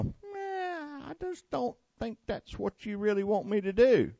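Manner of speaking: high, soft, whiny mock voice of a reluctant believer; mock reluctant reply. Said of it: "and we go".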